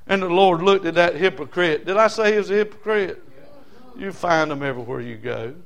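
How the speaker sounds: ordinary talk, nothing marked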